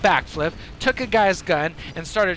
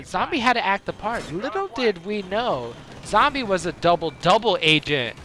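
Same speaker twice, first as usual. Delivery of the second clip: ordinary talk, nothing marked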